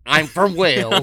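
loud, high send-up of a bad Welsh accent; mocking failed Welsh accent. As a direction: mimic a hopeless Welsh accent. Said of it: "You didn't have an accent. You just did this".

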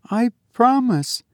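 put-on high, puzzled girl's voice; wondering. A narrator's reading. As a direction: agree wonderingly, pitch well up. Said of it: "said Olive wonderingly".

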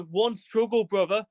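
high, impassioned plea of brotherhood; rallying appeal. Acted. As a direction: win them over with mock-earnest fervour. Fervent appeal of solidarity to Taliban.

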